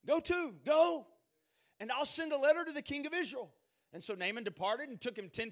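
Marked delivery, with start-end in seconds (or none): 0.1-3.5 loud, high, commanding call; king's command. Acted. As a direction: shout the order out like a king. King of Syria sends Naaman off.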